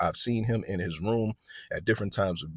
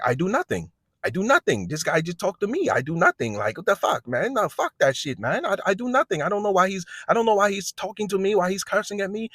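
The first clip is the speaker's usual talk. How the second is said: put-on much higher, pleading voice of an upset boy; hammed-up I-did-nothing whine; boy insisting to the officer he did nothing, high protest; protesting teen; 10 semitones higher than usual. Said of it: "he's like".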